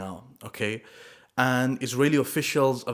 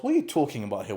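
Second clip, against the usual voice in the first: normal talking voice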